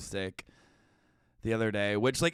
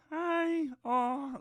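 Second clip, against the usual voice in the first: put-on squeaky baby-talk coo, much higher; cooing dog owner; 13 semitones higher than usual. Owners cooing at their dogs.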